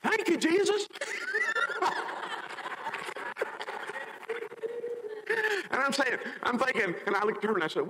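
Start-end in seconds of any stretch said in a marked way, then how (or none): 0.0-0.9 high, loud thank-you cry; rabbi's praise. Retold or imitated. Rabbi joining the crowd's praise, high and loud. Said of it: "And he went". 0.9-5.7 high delighted laugh. Real, not acted. Laughing at the rabbi.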